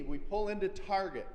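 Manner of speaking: normal talking voice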